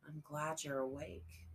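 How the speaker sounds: soft and gentle; quietly, almost tender. A narrator's reading. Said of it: "Then softer".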